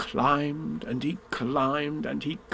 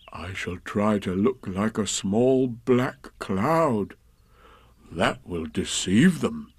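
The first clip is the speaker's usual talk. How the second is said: slow, ponderous bear; lightly voiced scheming bear; 3 semitones lower, slower than usual. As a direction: announce a plan very slowly as a bear.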